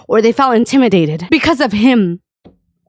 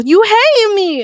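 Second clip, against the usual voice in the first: hammed-up slow, high-pitched whine of accusation; mock hurt accusation; 10 semitones higher, slower than usual. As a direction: imitate a wounded friend, high and drawn out. Acting out his offended accusation.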